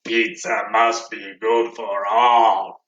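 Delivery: low, slow Cookie Monster voice. Shown as a demonstration. Ending the chant for kids in Cookie Monster voice, low. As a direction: growl it like a hungry monster. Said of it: "Did you do that Cookie Monster voice".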